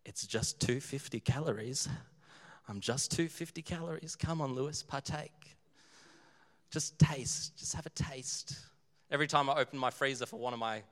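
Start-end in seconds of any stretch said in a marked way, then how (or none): 0.1-8.6 soft, tempting near-whisper; hushed, teasing voice of temptation; taunting cupcake. Acted. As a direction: coax like a sneaky cupcake, ending in a whisper. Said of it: "the cupcake in my freezer is taunting me".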